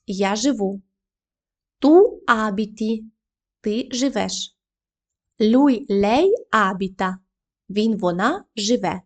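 ordinary talk

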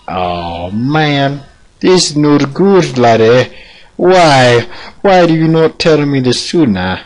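high, complaining put-on accent; dismayed pupil character. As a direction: wail mock dismay at the master.

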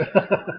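short, quiet amused chuckle; scornful laugh. Real, not acted. Campaigner mocking another statement from the housing group, scornful laugh. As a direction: chuckle briefly in disbelief.

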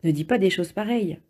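mildly scandalized reproof; understated outrage. A narrator's reading. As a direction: scold with a touch of shock. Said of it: "s'exclama Ralph scandalisé".

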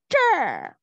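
这 with the mouth wide open and a falling tone; zhe with open mouth. Shown as a demonstration. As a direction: open up and say 这 clearly. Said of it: "Open your mouth".

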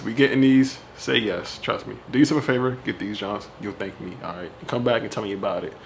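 usual speaking voice

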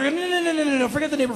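high, rattled string of refusals; frantic dismissal. Retold or imitated. Homeowner brushing off burglar's excuse, frantic and high. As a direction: cut him off in a high-pitched flurry.

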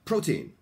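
"Protein" with PRO pitched high and strong; stress on first syllable. Shown as a demonstration. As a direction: punch PRO in "Protein", drop on "teen". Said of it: "最初の音節の方が大きいんですよ".

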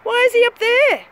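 baffled outburst at the man above the tower; exasperated outcry. Real, not acted. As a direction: throw the question up at him in disbelief. Repeats question at man on tower.

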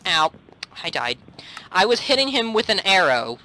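usual speaking voice

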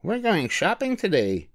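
bright mother announcing the trip; lightly voiced mother koala.

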